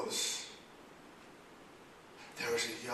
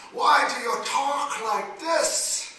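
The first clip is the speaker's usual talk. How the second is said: loud, slow, high scolding father; a father yelling at his daughter to behave; angry father; 9 semitones higher, 12 dB louder, slower than usual. Acted. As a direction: shout at your stubborn daughter.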